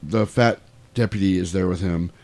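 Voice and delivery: ordinary talk, nothing marked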